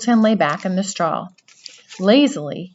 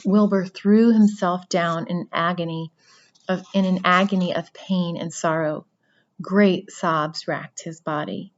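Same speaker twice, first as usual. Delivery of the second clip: usual speaking voice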